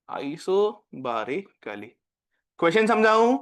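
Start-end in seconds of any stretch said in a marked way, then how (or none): 0.1-1.9 "isobarically" stretched even slower and softer, each syllable apart, falling; slower, softer dictation. Shown as a demonstration. Teacher repeating the word for students copying, very slow and soft. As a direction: repeat "isobarically" very slowly and quietly. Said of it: "question लिखो".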